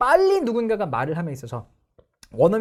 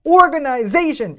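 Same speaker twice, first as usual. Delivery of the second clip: organization said slow and loud with both stresses equally heavy; the wrong, evenly stressed organization; 8 semitones higher, 9 dB louder, much slower than usual. Shown as a demonstration. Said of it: "이게 아니라".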